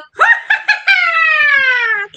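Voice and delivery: high, long falling cackling wail; witch's wail. A narrator's reading. Leading children in the witch's wail, high wailing cry. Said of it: "ready for a witch's wail".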